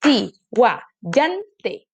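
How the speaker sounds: "Tihuayante" split as TI-HUA-YAN-TE; syllable by syllable. Shown as a demonstration. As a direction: separate beats on each syllable of "Tihuayante". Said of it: "A ver esta".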